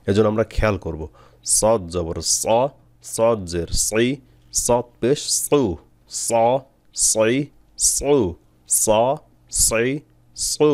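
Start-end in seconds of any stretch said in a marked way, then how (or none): 1.6-10.7 স সি সু with the tongue root raised, heavy sad; heavy sad drill. Shown as a demonstration. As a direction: drill সদ জবর স slowly, thick and heavy. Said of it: "জিহ্বার গোড়া উপরের দিকে উঠবে". (ভারী সদ, ধীরে ধীরে মশক)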